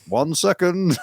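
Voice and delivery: mock gruff Englishman calling out. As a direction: call back through the door, drawn out. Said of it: "this gruff English voice go".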